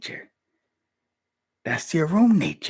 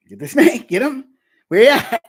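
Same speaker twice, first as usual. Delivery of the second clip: high, loud playful shouting; mock snake hunt; 13 semitones higher, 13 dB louder than usual. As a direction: shout like chasing a snake through the house.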